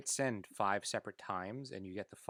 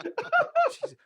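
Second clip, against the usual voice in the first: laughing squeal; 12 dB louder than usual. Real, not acted.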